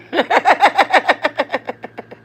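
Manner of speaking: high, loud rapid-fire laugh; real laugh. Real, not acted. Reacting to comment about cussing, real high laugh. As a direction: burst into a long run of giggles.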